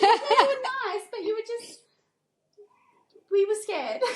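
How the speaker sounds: high, laughing imitation of the girl; laughing staff quote. Staff member admitting they were scared, said laughing in a high voice. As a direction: laugh through the admission. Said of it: "And I was like".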